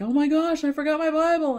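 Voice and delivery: high, loud gasping alarm; sudden panic at a lost Bible; panicked realization. Retold or imitated. Retelling arrival in France, high panicked cry.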